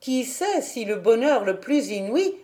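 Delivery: usual speaking voice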